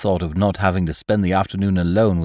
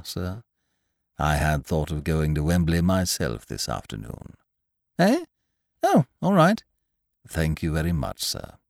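deferential butler; 6 semitones lower, 3 dB quieter than usual. Acted. Jeeves speaks.

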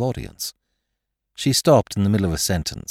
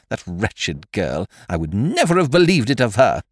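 indignant wife; 2 semitones higher, 4 dB louder than usual. Acted.

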